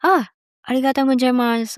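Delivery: arigatou gozaimasu said quickly in Japanese; quick polite Japanese thank-you. Retold or imitated. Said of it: "I was saying in Japanese".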